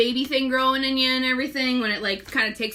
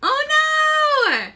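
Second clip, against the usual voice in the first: surprised cry; 5 dB louder than usual. Real, not acted. Suddenly remembers a missing step.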